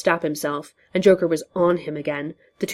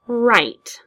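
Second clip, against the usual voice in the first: higher, odd-toned sly answer; Joker voice; 6 semitones higher, 4 dB louder than usual. Acted. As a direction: lie it with a grin.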